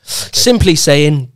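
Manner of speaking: simply saying drawn out slowly, each syllable placed; slow careful retry. Real, not acted. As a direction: take it again slowly after tripping. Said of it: "Easy for you to say".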